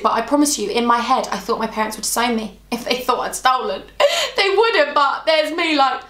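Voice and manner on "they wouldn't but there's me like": laughing it off, higher. Real, not acted. Vlogger laughing at her teenage panic. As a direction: giggle through the words. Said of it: "laughing at her own fear".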